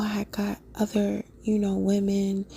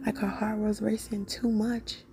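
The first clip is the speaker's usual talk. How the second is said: ordinary talk, nothing marked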